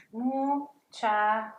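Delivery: Muchacha stretched out very slowly; slow Spanish model. Shown as a demonstration. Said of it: "let's practice right here".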